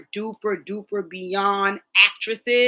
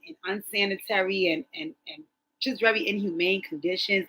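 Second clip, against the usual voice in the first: usual speaking voice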